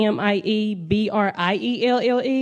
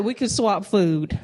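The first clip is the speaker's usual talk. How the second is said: ordinary talk, nothing marked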